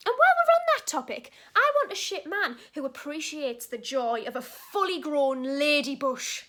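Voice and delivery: loud outburst, starting high, slowing to finish; impassioned rant. Acted. Telling a date what she demands, loud impassioned rant. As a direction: proclaim it loudly, stretching the last two words.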